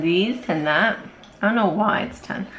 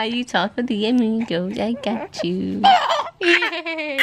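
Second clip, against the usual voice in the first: ordinary talk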